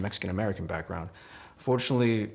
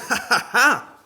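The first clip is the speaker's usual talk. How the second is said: laughing; 8 dB louder, slower than usual. Acted. Laughs mid-poem.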